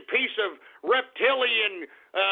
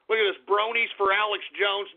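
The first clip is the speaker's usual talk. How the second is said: ordinary talk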